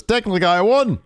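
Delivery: high, loud protest; mock indignant retort. Wrestler answering that he lost, high indignant mock protest. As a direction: imitate a sore loser protesting up high.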